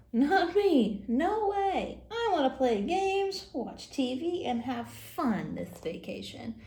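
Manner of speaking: put-on a stubborn child listing what he'd rather do; whiny kid. Kid protesting Bible school to his mom, whiny. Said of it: "Oh, here we go".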